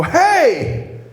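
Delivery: high, loud "Hey!"; confrontational shout. Retold or imitated. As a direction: yell it at an unwelcome caller. Rips the door open on pastor.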